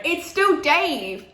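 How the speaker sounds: loud, high and drawn out; big gleeful announcement; excited exclamation. Real, not acted. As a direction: belt it out with delight. Excited answer about favourite famous contact.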